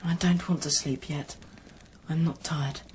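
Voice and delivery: ordinary talk, nothing marked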